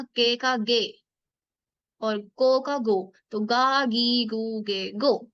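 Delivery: each syllable stretched slowly for the class; slow syllable drill. Shown as a demonstration. Teacher spelling kana for students, slow. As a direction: draw out every kana sound. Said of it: "teacher drawing out syllables".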